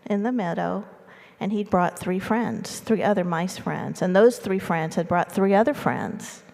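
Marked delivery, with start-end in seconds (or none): none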